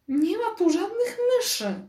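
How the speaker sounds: teacher flatly denying the mouse to her class; firm and decisive. A narrator's reading. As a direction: shut the matter down firmly. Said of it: "tonem jeszcze bardziej zdecydowanym".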